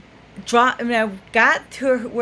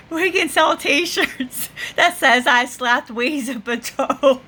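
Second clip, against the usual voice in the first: higher, slowed by giggles; laughing; 4 semitones higher than usual. Real, not acted. Driver recalling a funny Steel Magnolias scene, laughing. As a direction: quote the film line while cracking up.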